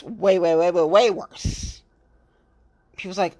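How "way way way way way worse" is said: each way leaned on, slowing into worse; mounting emphasis; emphatic repetition. Real, not acted. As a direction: pile up the ways, drag out way worse.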